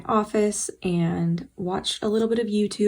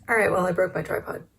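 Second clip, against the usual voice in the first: ordinary talk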